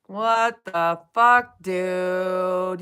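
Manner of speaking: slow, drawn-out disbelief; slow incredulous. Real, not acted. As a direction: stretch it out in disbelief. Outraged at the streamer.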